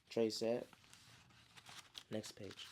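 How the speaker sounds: usual speaking voice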